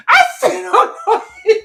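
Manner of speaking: high laughing cry. Real, not acted. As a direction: laugh through the words, high and loud. Laughing retelling her reaction.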